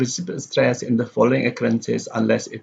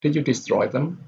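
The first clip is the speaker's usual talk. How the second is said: tune rises from "destroy" to "them"; rising intonation; 3 semitones higher than usual. Shown as a demonstration. Teacher repeats the yes/no example, rising on "destroy". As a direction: lift the question up on "destroy". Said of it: "destroy here goes up".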